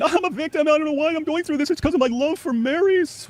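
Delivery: very high, whiny victim voice; high mocking victim whine, slowing at the end. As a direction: squeak it out like a self-pitying martyr. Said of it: "And then".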